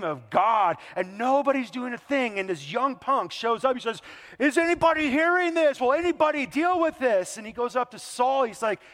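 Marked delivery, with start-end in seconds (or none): 4.4-7.4 much higher and louder, outraged youth; indignant shout. Retold or imitated. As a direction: call out to the whole army in disbelief. Young David outraged at Goliath.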